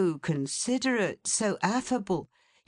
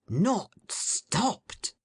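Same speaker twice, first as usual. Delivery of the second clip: exclaimed; 3 semitones higher than usual. A narrator's reading. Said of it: "exclaimed the Alderman".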